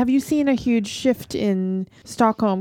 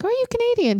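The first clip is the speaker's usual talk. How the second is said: high, eager hammed-up question; mock bright question; 14 semitones higher than usual. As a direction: pop the question up high and friendly. Retold friendly question to stranger.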